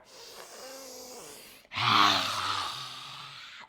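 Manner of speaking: long, forceful breathy sigh; big released exhale. Shown as a demonstration. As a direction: let all the air rush out. Demonstrates untamed exhale.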